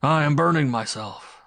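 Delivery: high, loud cry of pain. A narrator's reading. Man flings the woman away, crying out in pain. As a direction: cry out suddenly as if burned.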